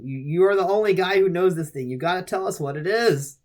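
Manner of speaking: mock frustration, pressing for an answer; exasperated. Baffled doubter begging the only expert, exasperated.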